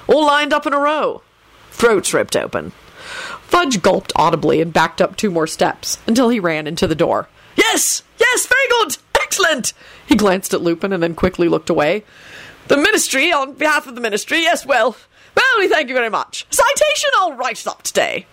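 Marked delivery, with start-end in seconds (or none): 0.1-2.7 pleasant relish. A narrator's reading. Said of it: "He smiled pleasantly at Fudge". 7.6-9.7 high-pitched flustered exclamations; panicky approval. A narrator's reading. Frightened minister blurts praise. 12.7-18.3 high, nervous official babble; flustered thanks. A narrator's reading. Frightened minister flusters through thanks.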